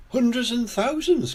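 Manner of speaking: high-pitched little pig exclamation; crying out. A narrator's reading. Peppa picks up spilled batteries, crying out high. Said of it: "cries Peppa".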